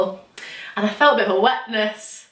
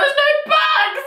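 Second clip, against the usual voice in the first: very high, slow whine; mock distraught wail; slower than usual. Telling family the spare bags are gone, high distraught wail. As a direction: wail it in hammed-up despair.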